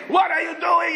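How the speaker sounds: high-pitched alarmed cry; panicked. Retold or imitated. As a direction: shriek the question in a mock panic. David panics in careening jeep.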